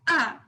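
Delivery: "ah" as a quick falling tone; sharp fourth tone. Shown as a demonstration. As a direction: say "ah" short with a sharp fall. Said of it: "fourth tone, which is sharp".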